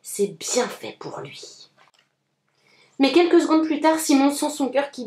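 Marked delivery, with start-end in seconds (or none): 0.0-1.7 low, soft and slow, pleased with himself; a brother gloating quietly over his sibling's trouble; smug satisfaction. A narrator's reading. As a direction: savour it with a satisfied smile. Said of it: "un sourire satisfait et il dit".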